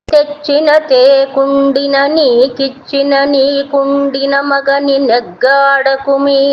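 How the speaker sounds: slow, sing-song chanting of a classical verse; slow chanted Telugu poem; poem recitation. Acted. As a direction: intone the padyam slowly and evenly, like a recital.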